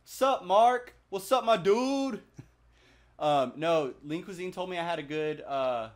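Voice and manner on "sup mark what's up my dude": high, loud dude-bro greeting; imitating a friend's voice. Imitating a friend's greeting for chat, high and loud. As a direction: greet him like a hyped bro. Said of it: "[name]'s pretty damn good".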